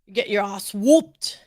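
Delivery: slow, drawn-out mimicry of a British accent; imitated British accent. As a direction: copy the British accent, stretching each word. Copies the other's accented phrase.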